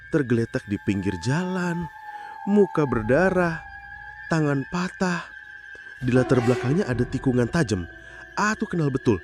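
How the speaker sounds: ordinary delivery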